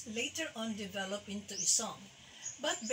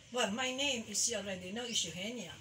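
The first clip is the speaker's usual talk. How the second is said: normal talking voice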